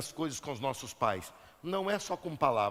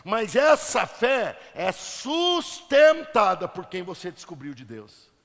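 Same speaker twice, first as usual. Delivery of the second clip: loud, rising sermon delivery; forceful preaching; 7 semitones higher, 8 dB louder than usual. Real, not acted. Preacher urging the congregation to obey the Father, forceful preaching. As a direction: drive each phrase home to the crowd.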